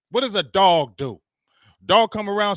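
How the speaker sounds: ordinary talk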